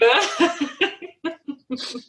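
high, quick laugh at herself; bright laugh. Real, not acted.

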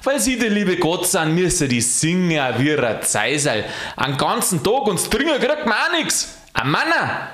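indignant complaint rising very high; ungracious ranting. A narrator's reading. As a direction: grumble back, climbing to a high squeal of protest. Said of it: "einmal im Schimpfen drin".

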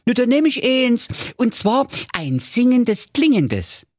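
put-on high, delighted young man; delighted Marco. Marco happily orders a singing tree from the dwarf. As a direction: gush with delight. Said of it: "sagte er entzückt".

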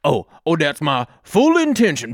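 ordinary talk

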